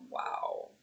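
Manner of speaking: breathy unvoiced disbelief; stunned whisper. Mother answering the rude clerk, whispered disbelief. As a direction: whisper it, taken aback. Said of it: "I'm like".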